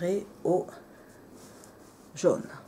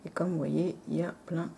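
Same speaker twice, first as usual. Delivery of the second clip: ordinary talk, nothing marked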